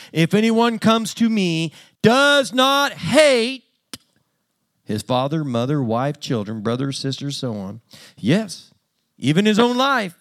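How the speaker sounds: rising preaching voice, the word hate stretched long; fiery preaching of the verse; scripture read with force. Acted. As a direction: preach the verse, pressing hard on hate and cannot.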